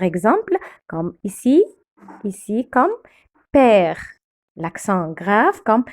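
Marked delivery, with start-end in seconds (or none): 3.5-4.0 père with an open "è". Shown as a demonstration. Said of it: "On ouvre la bouche". (père avec un « è » bien ouvert)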